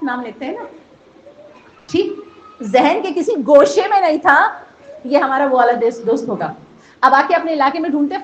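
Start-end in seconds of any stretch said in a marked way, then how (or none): 2.6-8.2 louder, rising sermon climax; preaching at full force. Acted. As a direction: drive the point home to the gathering.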